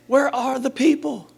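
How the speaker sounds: high-pitched fretful question; mock fretting. Voicing a worried leader's question.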